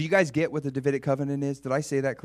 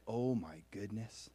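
overwhelmed awe; 3 semitones lower, 9 dB quieter, slower than usual. Acted. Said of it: "imagine how you would feel like".

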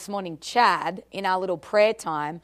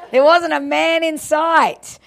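higher, louder, laughing through it; laughing repeat; 6 semitones higher, 5 dB louder than usual. Real, not acted. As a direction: land the joke with a laugh.

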